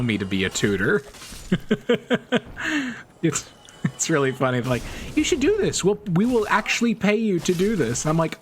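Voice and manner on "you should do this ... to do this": eager professors. Retold or imitated. Imitates professors urging her to tutor.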